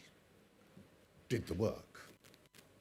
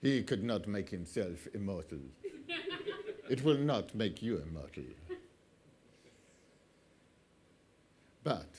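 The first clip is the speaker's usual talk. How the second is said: mock tobacco ad; 4 semitones lower than usual. Parodying an old tobacco ad, amused. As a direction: sell it like a cheesy cigarette commercial.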